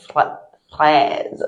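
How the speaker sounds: normal talking voice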